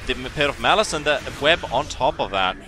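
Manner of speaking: loud, driving fight commentary; urgent play-by-play. Real, not acted. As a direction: call the chase with rising urgency.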